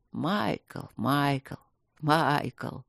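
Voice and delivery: low, hollow call of a spirit; eerie calling voice. A narrator's reading. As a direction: call his name like a ghost. Evil voice calls him. (низкий потусторонний зов)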